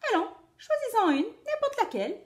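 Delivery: high little bunny voice; squeaky rabbit. Acted. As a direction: coax like a clever rabbit. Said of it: "dit-il".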